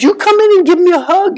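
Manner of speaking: high hopeful plea; eager young friend. Retold or imitated. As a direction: voice the younger man asking for a hug. Friend's wish voiced eagerly.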